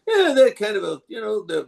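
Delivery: very high cartoon voice; put-on character voice. Shown as a demonstration. Actor showing interviewer a voice option, squeaky character. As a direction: jump into a squeaky little character.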